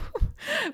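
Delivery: high, breathy nervous giggle; a jittery little laugh. Real, not acted. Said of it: "this is my nervous voice".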